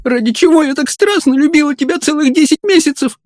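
put-on very high, girlish weeping voice; tearful lament. A narrator's reading. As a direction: wail reproachfully at the beloved. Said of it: "плакала Элиза".

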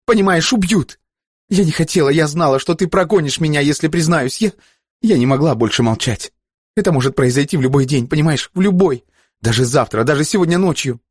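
high-pitched, frantic warning; desperate outcry. A narrator's reading. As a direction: pour it out in rising desperation. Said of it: "выкрикнула Бланка ему в лицо". (отчаянно, срываясь на крик)

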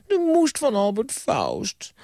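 put-on very high, fast indignant boy; childish Bram. Bram brushing off the ghost, very high childish voice. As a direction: squeak it back like a defiant kid.

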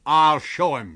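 loud threatening shout; angry shout. A narrator's reading. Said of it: "shouted the American".

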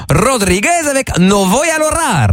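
a hint of a Spanish accent, hammed up. Radio host reading a listener's message in a mild Spanish accent. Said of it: "magnifique l'accent espagnol".

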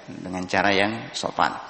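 ordinary delivery

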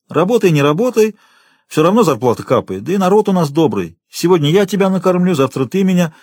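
ordinary delivery, nothing marked

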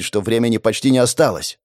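usual speaking voice

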